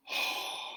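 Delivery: soft open yawn-sigh. Shown as a demonstration. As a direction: let it all go on a sigh. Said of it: "letting it go with just an open yawn".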